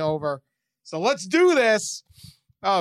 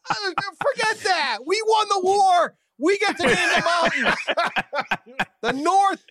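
mock triumphant; 10 semitones higher than usual. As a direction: gloat like a Northerner who won the war. Mock Yankee victory rant.

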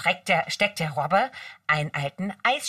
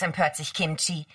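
ordinary delivery, nothing marked

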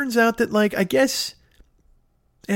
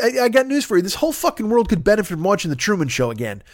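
normal talking voice

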